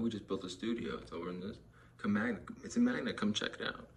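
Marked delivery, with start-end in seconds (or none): 2.0-3.9 higher, louder eager pitch; salesman pitch. Retold or imitated. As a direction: sell it like an eager dealer. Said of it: "quoting salesman pitch".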